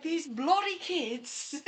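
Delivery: high, slow, loud mock British; imitating a friend's British accent; drawn-out hammed British grumble. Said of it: "talking in a British accent".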